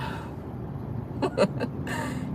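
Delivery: low, quiet breathy chuckle; soft chuckle to herself; laugh. Real, not acted. Host chuckling at heated comments, quiet laugh.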